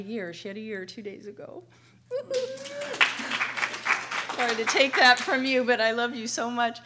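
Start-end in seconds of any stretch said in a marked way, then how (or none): 2.1-4.3 high, loud laugh breaking into tears; real laugh-cry; tearful laugh. Real, not acted. Thanking her sponsor, laughing through tears. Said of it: "I think that's why I'm emotional". 4.4-6.8 much louder, pushed out through tears; real gush of love and tears; emotional outburst. Real, not acted. Telling her sponsor she loves her, loud and tearful. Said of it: "I think that's why I'm emotional".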